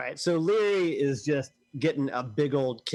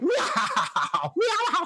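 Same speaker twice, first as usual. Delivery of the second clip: laughing meow. Acted. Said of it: "Literally starts laughing like".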